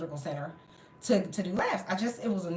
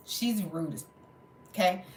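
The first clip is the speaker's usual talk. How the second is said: usual speaking voice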